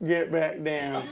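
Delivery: low, acted order; Shrek. Shrek sends both peeking heads away, low command. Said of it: "Shrek looks at 'em both, and he goes".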